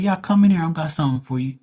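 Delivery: daring taunt. Retold or imitated. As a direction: invite him in, menacing. Speaker daring the customer.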